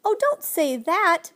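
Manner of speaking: high, loud, drawn-out plea; desperate protest; desperate cry. A narrator's reading. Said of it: "Ben cried desperately".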